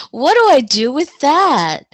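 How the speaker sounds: a bewildered laughing comeback to her husband; half-laughing, half-exasperated question; laughing disbelief. Retold or imitated. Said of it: "And I was like".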